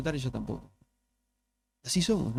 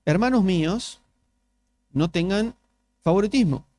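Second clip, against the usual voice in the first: scripture reading; 4 dB louder than usual. Acted. Reads scripture aloud.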